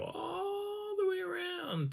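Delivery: "all" stretched very long and high; drawn-out "all". Retold or imitated. Teller stresses the follower's long detour, "all" drawn out. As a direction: hold "all" high before sliding into "the way around".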